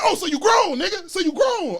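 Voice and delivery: loud needling accusation, retold; mock confrontation. God brother's taunting challenge voiced.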